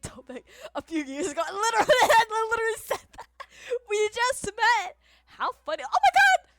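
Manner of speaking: loud, high squeal through laughter; giddy, shrieking disbelief; overexcited freak-out. Real, not acted. Overexcited reaction to the reveal.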